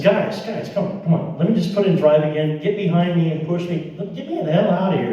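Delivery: high, impatient coaxing; urgent pleading. Retold or imitated. Stuck driver urging two strangers to push, pleading.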